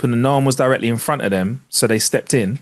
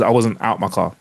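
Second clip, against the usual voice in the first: ordinary delivery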